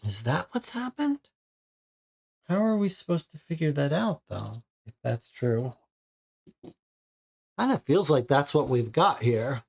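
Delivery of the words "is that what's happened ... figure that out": rising, incredulous questions; surprised. Real, not acted. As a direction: realize the twist in disbelief. Player realizes baby twist.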